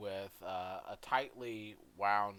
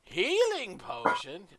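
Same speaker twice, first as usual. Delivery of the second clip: high, loud, drawn-out question; shopkeeper character; 13 semitones higher, 12 dB louder, slower than usual. Acted. Shopkeeper puzzling over the customer's order, slow and high. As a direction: stretch it out in a high-pitched voice.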